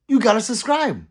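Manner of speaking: loud, high-pitched sales push; emphatic call-out. Acted. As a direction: call it out as a playful demand. Playful push to subscribe.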